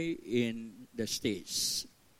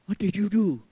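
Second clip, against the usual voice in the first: higher, louder alarmed "what did you do"; alarmed question; 6 semitones higher, 7 dB louder than usual. Retold or imitated. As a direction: voice the wife's shocked worry, a bit louder. Wife's alarmed email quoted.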